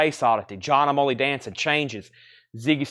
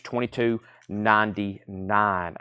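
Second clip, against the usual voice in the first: ordinary talk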